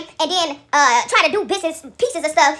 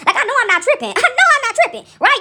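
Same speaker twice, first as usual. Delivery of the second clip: laughing disbelief; 7 semitones higher, 4 dB louder than usual. Real, not acted.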